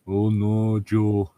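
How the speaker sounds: ordinary delivery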